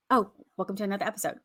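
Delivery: "welcome to another episode" mumbled fast and soft; rushed trial take. Shown as a demonstration. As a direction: toss off "welcome to another episode" quickly under the breath. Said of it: "Okay, let's start over".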